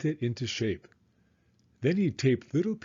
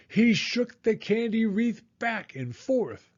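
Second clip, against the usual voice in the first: higher, racing excitement; frantic; 7 semitones higher than usual. A narrator's reading. Narrator telling Dragon's frantic binge, excited and high. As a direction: tell it like a wild sugar rush.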